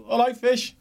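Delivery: high imitated greeting; high imitating of the old man's greeting; high mock greeting. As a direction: squeak out a mock hello. Speaker's own greeting imitated.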